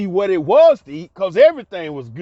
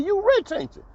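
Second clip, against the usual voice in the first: teasing mom; 12 semitones higher, 4 dB quieter than usual. Retold or imitated. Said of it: "hand on her hip".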